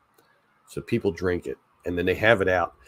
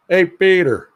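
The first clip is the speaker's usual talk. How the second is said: much higher, louder cartoon voice greeting Peter; Family Guy impression; 9 semitones higher, 9 dB louder than usual. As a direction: squeak it out like a cartoon neighbor. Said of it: "my impression of him".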